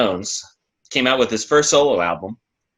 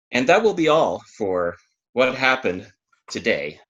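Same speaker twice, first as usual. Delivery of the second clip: normal talking voice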